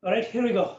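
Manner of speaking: usual speaking voice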